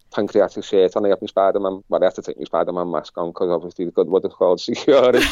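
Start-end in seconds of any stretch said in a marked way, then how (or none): none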